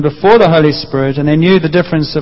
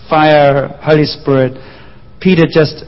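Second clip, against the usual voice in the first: usual speaking voice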